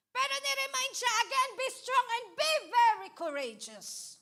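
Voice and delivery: fiery pulpit preaching; preaching at full force. Acted. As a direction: proclaim the verse to the congregation. Preacher repeats verse forcefully.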